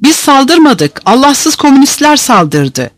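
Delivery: high-pitched indignant denial; heated protest. A narrator's reading. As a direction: protest your innocence, pitched well up. Accused prisoner protests to guards.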